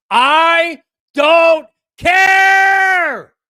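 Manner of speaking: drawn-out, very slow, very loud and high; fed-up roar at the critics; exasperated shout. Real, not acted. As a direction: bellow it out in total disbelief. Host ranting about fan complaints.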